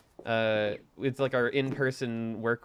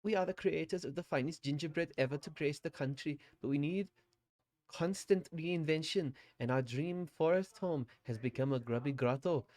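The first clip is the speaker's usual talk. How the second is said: hammed-up German accent, pitched higher; terrible German accent; 3 semitones higher, 4 dB quieter than usual. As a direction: play a cheesy German confectioner. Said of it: "a really terrible German accent".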